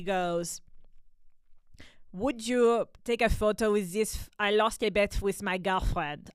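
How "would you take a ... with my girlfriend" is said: put-on Central European accent; imitated Swiss or Dutch tourist accent. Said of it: "I'm doing a Swiss Dutch accent thing".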